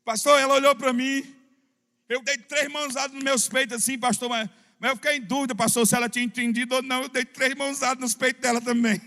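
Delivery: imitating a breathless, hurried congregant; hurried excited brother. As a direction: blurt it out fast and high like an excited brother telling the pastor. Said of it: "ele falava bem apressado".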